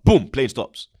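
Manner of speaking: ordinary talk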